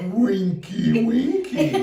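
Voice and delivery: very high, loud squealed punchline; teasing friend. Retold or imitated. Storyteller delivering friend's word as punchline, high loud mimicry. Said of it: "that's what she was referring to".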